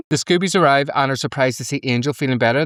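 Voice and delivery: normal talking voice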